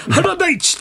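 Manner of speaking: high, drawn-out shout of an athlete's name; excited name call. Pretend. Co-host cheering an athlete's name, excited high call. 連呼する選手名の掛け声.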